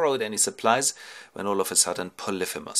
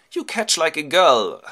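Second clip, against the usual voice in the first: high, loud, slow jeer; girlish taunt; 7 semitones higher, 8 dB louder than usual. Acted. Nausicaa playing with her friend, loud high taunt. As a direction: tease a playmate in a loud high voice. Girls playing ball, acted.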